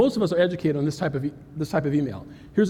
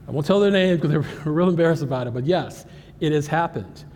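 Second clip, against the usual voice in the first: ordinary talk, nothing marked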